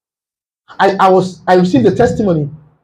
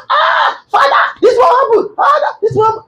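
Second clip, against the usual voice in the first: mock shouting prayer. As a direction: shout it like a frantic useless prayer. Mocking loud ineffective prayer.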